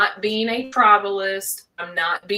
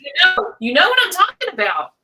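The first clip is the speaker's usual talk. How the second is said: excited; 10 semitones higher, 8 dB louder, faster than usual. Real, not acted. Excited agreement.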